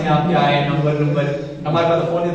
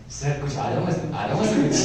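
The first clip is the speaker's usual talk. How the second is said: mock pleading, softer, half-whispered; pleading with judges; 4 semitones lower, 7 dB quieter than usual. Contestant begging the judges for marks, mock whispered pleading. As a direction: beg the judges meekly under your breath. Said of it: "लगा हुआ जजेस के पाँव पकड़ने".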